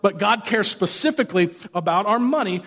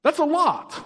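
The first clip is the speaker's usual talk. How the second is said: incredulous balk; 5 semitones higher than usual. Retold or imitated. Rich man balks at Jesus.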